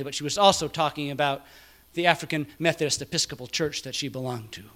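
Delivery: usual speaking voice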